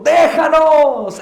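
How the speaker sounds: high, drawn-out shout; loud cry. Acted. Possessed man crying out to Jesus in the synagogue, loud shout. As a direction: cry it out slowly at full voice. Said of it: "a gran voz diciendo".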